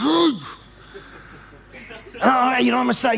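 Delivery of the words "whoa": high exasperated cry; mounting rage. Retold or imitated. Driver fuming at the blocked road, acted rage. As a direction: re-enact a burst of frustration. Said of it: "rage is starting to come out".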